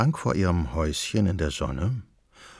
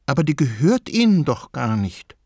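higher, louder protest; protesting grandmother; 5 semitones higher, 4 dB louder than usual. A narrator's reading. Grandmother protests the grinder isn't his, high and firm. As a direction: object like a stubborn granny.

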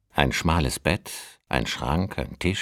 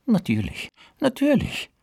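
high, fluttering agreement; eager old woman. Acted. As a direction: gush like an eager widow.

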